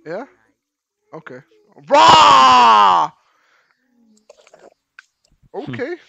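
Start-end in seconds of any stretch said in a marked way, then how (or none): 1.9-3.1 loud beastly roar; roaring grunting brute. Pretend. Loud roar at furry player.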